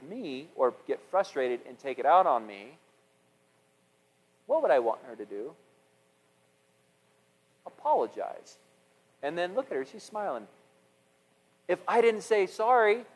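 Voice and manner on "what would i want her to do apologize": normal talking voice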